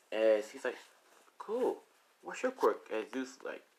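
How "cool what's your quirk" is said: put-on high-pitched curious kid; child's voice. Shoto asking a new friend, high kid voice. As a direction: ask like a small child.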